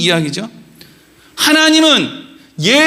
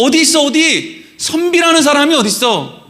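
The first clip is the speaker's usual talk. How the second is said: higher-pitched, punchy repeated challenge; urgent colloquial calling-out, pitched up; insistent searching demand; 4 semitones higher than usual. Shown as a demonstration. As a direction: demand that 선비 show himself. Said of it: "이런 식으로 읽는 거예요".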